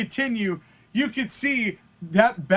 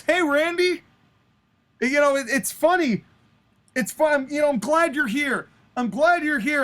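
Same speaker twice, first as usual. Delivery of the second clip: higher-pitched, lightly acted address to a rival; wry wrestler comeback; 5 semitones higher than usual. Wrestler answering his gloating rival, mock-friendly. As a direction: open the comeback with pointed friendliness.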